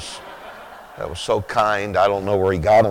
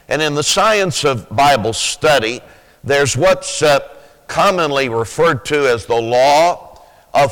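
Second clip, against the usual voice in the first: raised, fervent preaching voice building to full force; 4 semitones higher, 3 dB louder than usual. Acted. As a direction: preach it out to the congregation with rising fire.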